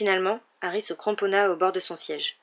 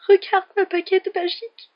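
high, louder, distressed lament; trembling voice; 12 semitones higher, 6 dB louder than usual. A narrator's reading. Ron showing Harry his broken wand, trembling. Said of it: "répondit Ron d'une voix tremblante".

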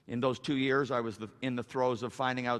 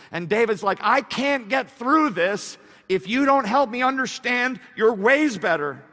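loud, high, drawn-out key words; slow down and belt out each phrase; full-force preaching; 10 semitones higher, 11 dB louder than usual. Acted. Preacher voicing David's plea to congregation, full-force preaching.

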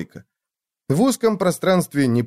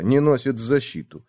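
plain reading voice

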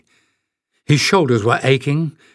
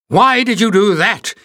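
high, loud angry shout; furious shout; 9 semitones higher, 5 dB louder than usual. Acted. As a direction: yell at her, furious at being woken. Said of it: "he shouted".

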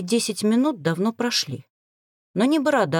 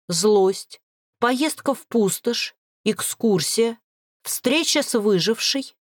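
ordinary delivery

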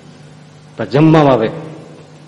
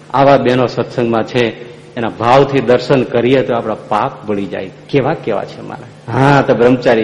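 ordinary delivery

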